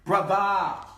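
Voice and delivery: "Brother" as "bruh-thah", loud and high; "Brother" ringing out on a final ah; AH in the ending. Shown as a demonstration. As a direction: belt "Brother" with an open ah for the er. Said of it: "We put the syllables ah in it".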